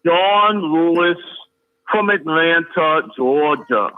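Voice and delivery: "John Lewis" drawn out very slowly, each word weighted; slow emphatic naming. Real, not acted. Co-host naming the accused lawmaker, "John Lewis" in slow emphatic stretch. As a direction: stretch "John Lewis", then "from Atlanta, Georgia" slow and deliberate.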